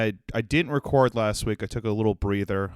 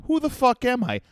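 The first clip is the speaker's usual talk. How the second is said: sudden high squeak; 12 semitones higher than usual. Real, not acted. Sudden self-mocking outburst.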